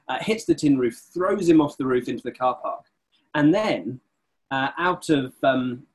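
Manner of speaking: normal talking voice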